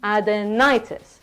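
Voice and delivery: "adenitis" slowly, leaning on the NI; stress on NI. Shown as a demonstration. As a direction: stretch the NI in "adenitis". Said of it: "the stress is on the ni".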